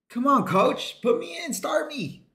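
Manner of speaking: high, soft begging voice; playful pleading. Pretend. Player begging the coach to start him, playful pleading.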